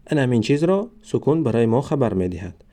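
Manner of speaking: ordinary delivery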